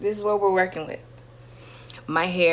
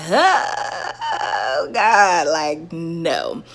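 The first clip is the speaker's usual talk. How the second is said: disgusted; 4 dB louder, much slower than usual. Retold or imitated. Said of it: "I'm just like".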